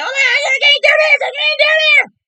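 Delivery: high, loud, frantic crying shout; hysterical Michaela. Retold or imitated. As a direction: wail it like she's losing it over a bug. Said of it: "She jumps up, swatting like".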